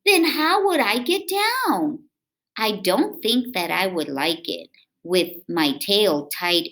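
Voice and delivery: lively, sing-song read-aloud voice; reading to children. A narrator's reading. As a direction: read it to little kids with bounce. Reading picture book aloud.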